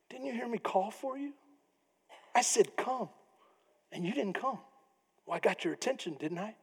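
hushed, knowing taunt from a spoiled son; quiet smug retort. Retold or imitated.

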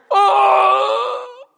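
long high-pitched mock howl; loud pained howl. Bully kicked hard in a fight, loud pained howl. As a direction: imitate a drawn-out cry of agony. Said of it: "all I hear is".

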